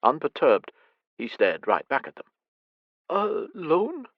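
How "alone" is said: croaked. Acted. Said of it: "Eleanor croaked".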